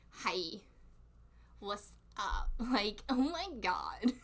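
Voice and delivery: put-on drawn-out Valley Girl accent. Streamer doing it to annoy people, drawling Valley Girl. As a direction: stretch the vowels like a mock Valley Girl. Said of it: "Valley Girl's fun".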